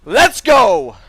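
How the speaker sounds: loud, high rallying yell; pumped-up, punchy holler; excited shout. Real, not acted. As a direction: shout it like cheering on a team. Cheering on the move.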